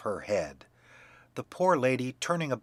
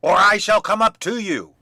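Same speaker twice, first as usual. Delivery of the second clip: angry shout; 8 semitones higher, 11 dB louder than usual. A narrator's reading. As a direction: bellow the order up the stairs. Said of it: "cried Bluebeard".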